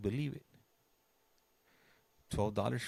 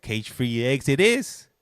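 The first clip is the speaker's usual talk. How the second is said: lightly mimicked wife, higher and loud; playful, slow, raised-pitch quote of the wife; imitating wife giving in; 6 semitones higher, 12 dB louder than usual. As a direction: draw it out loudly as a mock concession. Wife giving in, voiced in story.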